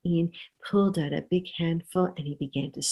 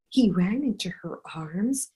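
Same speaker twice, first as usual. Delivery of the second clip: plain reading voice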